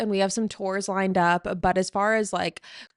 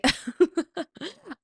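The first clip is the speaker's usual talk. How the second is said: real laugh; 9 semitones higher than usual. Real, not acted. Laughing after retold shock.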